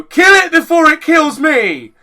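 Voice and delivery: high, loud, slow desperate shout; frantic yell. Pretend. You see a spider and want it dead, loud slow shout. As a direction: scream the order slowly. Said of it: "or you go".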